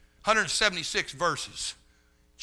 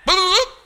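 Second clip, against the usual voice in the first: phone beeps; 12 dB louder than usual. Retold or imitated. Preacher mocking foreign phone callers with beeping sounds. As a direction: beep like pressing phone buttons. Mimicking hanging up again.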